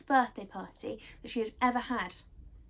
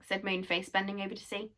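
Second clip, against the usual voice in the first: plain reading voice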